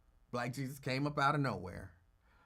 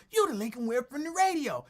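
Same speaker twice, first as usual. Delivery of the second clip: imitating Jimmy calling; 8 semitones higher, 5 dB louder than usual. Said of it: "Jimmy calling".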